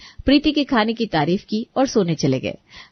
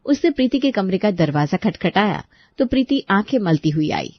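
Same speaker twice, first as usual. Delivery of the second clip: ordinary delivery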